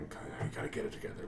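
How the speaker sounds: low, very quiet mutter; talking to himself. Acted. Game master voices a man at the sink, muttering to himself. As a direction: murmur it under your breath, nearly voiceless. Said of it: "a voice talking to himself".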